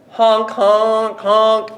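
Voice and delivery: high, loud clowning honks; silly clown. Retold or imitated. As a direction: honk and holler like a clown. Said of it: "And I would hear".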